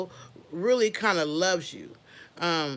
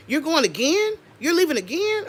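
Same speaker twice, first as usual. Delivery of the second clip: mock complaining wife; 7 semitones higher, 4 dB louder than usual. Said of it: "she'll say something like".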